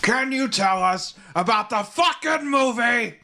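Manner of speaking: loud, high, drawn-out impression; Al Pacino. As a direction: belt it out slowly in an over-the-top movie-star voice. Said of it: "Can you do an Al Pacino impression?".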